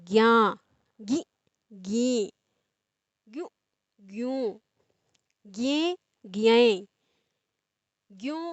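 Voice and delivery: slow, drawn-out chanting of syllable pairs; syllable drill recitation. Shown as a demonstration. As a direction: recite the vowel series like a teacher, evenly spaced. Recites syllable tables for children. (धीमे, खींचकर बारहखड़ी का पाठ)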